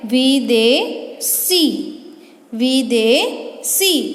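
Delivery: each word drawn out, rising then falling; slow modelling. Shown as a demonstration. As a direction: pronounce it slowly so students hear the ending. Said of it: "teacher models the derived word". (धीरे-धीरे उच्चारण)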